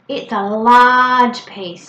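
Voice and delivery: "large" loud and drawn out; slow down and swell on "large". Shown as a demonstration. Parent describing toothpaste to a child, big long "large". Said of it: "using lots of big describing words".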